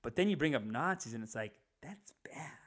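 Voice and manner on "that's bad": barely audible whisper; mock objection with no force behind it; half-hearted whispered scolding. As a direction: whisper a token disapproval. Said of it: "It's kinda like that".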